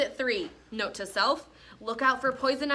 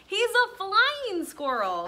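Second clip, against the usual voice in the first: high, slow, wondering reveal; big reveal; 9 semitones higher, slower than usual. A narrator's reading. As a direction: savor the surprise of the reveal. Reveal line in read-aloud.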